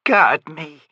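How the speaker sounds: plain reading voice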